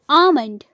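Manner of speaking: "आमंड" as AA-mund, l silent; long aa and no l in "आमंड"; correct pronunciation. Shown as a demonstration. Teacher giving learners the correct form, "आमंड" as AA-mund. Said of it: "इसकी correct pronunciation होगी".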